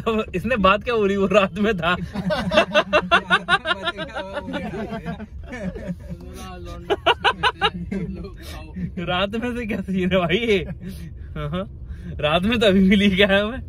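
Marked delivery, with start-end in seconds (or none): 2.0-9.0 long, high real laughing fit; helpless drawn-out laugh; long laughter. Real, not acted. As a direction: crack up and keep laughing. Cracks up at the remark.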